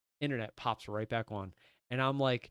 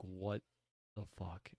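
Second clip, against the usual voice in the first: a hushed, stunned curse, much softer; 6 semitones lower, 12 dB quieter, slower than usual. Retold or imitated. Boyfriend sees the internet pop back on, hushed awed curse. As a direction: let it fall out of you in quiet disbelief. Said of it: "like, with this awe face".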